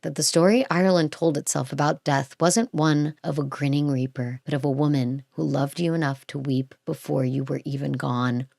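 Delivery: low, soft narration winding down; hushed, measured storytelling; solemn story narration. Real, not acted. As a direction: read the closing line gently, letting it sink. Reads out the written story.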